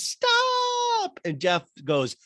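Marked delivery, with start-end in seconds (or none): 0.0-1.2 put-on very high, loud child's shriek; child screams stop at dad; kid screaming stop. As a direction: scream it like an exasperated little boy. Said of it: "And Max screams".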